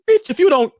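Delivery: high, loud retort, played for laughs; mock angry outburst. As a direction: burst out in mock outrage. Acted angry comeback in a story.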